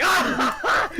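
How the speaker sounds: high shriek of laughter; laughing shriek. Retold or imitated. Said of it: "I'm like".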